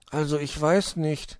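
zögernder Igel in etwas höherer Stimme; zweifelnd. A narrator's reading. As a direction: sprich mit leisem Zweifel, etwas höher. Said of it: "zweifelte Borstel". (zweifelnd, unschlüssig)

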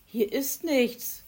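slow, loud call out of the cave; drawn-out shout. Acted. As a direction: call to friends outside. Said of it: "riefen sie nach draußen".

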